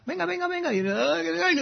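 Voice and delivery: very high, sing-song coaxing; playful baby talk, very high; cheering on a toddler. Pretend. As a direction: coax a little child to walk, voice way up. Cheering a toddler's first steps.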